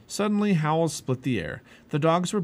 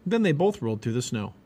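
ordinary delivery, nothing marked

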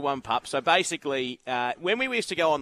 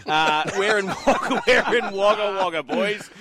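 laughing; 5 semitones higher, 6 dB louder, slower than usual. Real, not acted. Host cracks up announcing location.

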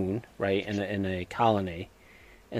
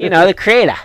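"crater" said as "kray-tuh" in a New England accent, loud and high; exaggerated New England "crater"; 9 semitones higher, 13 dB louder than usual. Shown as a demonstration. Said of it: "my New England accent".

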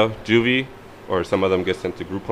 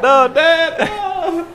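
high whiny mock sob, much higher and louder; mock crying plea; 5 dB louder, much slower than usual. Friend mocking him begging his dad through tears. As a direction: whine it like a bawling kid.

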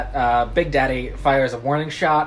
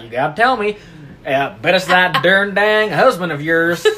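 insistent Texas dad, louder; Texan country accent; 5 dB louder than usual. Acted. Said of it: "he's got a big c-, country accent".

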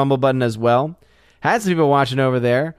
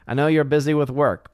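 ordinary talk, nothing marked

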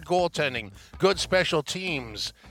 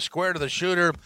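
ordinary talk, nothing marked

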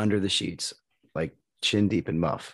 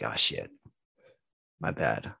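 much lower, deflated oh shit; sheepish oops; 10 semitones lower, 4 dB quieter, slower than usual. Retold or imitated. Caught out by his son, a much lower sheepish admission. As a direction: drop the voice low, caught out. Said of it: "I was like".

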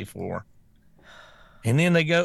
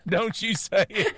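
laughing mock warning; 7 semitones higher, 3 dB louder than usual. Retold dread, laughing at the clip.

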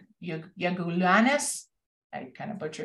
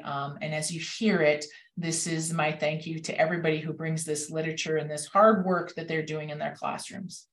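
ordinary talk

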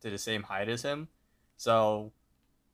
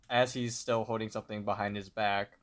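usual speaking voice

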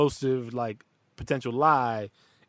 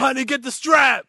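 high, loud hammed-up call; mock alarmed shout; 12 semitones higher, 8 dB louder than usual. Parent calling spouse to grab a gun, loud mock alarm. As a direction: yell to your partner in mock panic.

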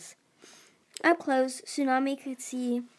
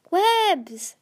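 sudden yell, much higher and louder; 9 semitones higher, 6 dB louder than usual. A narrator's reading. Tsunami yells after falling Webs, high loud cry. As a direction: cry out his name as he falls. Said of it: "Tsunami yelled".

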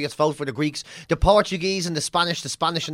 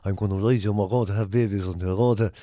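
much lower gruff lusty voice; mock lusty rebel; 8 semitones lower than usual. A lusty Irish rebel to his lover, low and gruff. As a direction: growl it low like a randy rebel.

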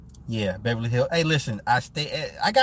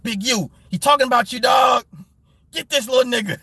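higher, louder hyped-up yelling to a friend; mock hype shout; 8 semitones higher, 6 dB louder than usual. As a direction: shout it like egging on a homie. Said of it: "Be like".

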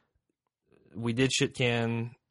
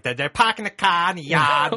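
high, loud mock Rhode Island accent; 6 semitones higher, 7 dB louder than usual. Imitating girls from Rhode Island, loud mock New England accent. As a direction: exaggerate the broad New England vowels, loudly. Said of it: "some chicks from Rhode Island".

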